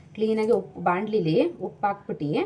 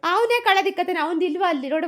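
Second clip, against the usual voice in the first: much higher, indignant; exasperated exclaim; 9 semitones higher, 4 dB louder than usual. Real, not acted. Mother exclaiming to viewers about her son, high and indignant. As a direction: exclaim in disbelief.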